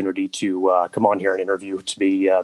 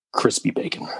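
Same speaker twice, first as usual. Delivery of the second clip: whisper; 4 dB quieter than usual. Acted. Callback to the bacon bit.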